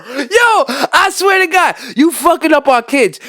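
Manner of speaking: high, loud slow outraged yell; outraged rant. Real, not acted. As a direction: shout it in mock outrage. Ranting about ASMR at kids.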